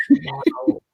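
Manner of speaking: high, dismayed no; acted shocked wail of refusal; aghast "no". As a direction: protest in horror, pitched right up. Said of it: "like, big O face".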